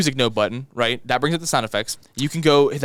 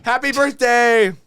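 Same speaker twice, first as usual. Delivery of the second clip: cheery shout; 9 semitones higher, 5 dB louder, slower than usual. Pretend. Random cheer.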